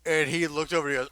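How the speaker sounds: usual speaking voice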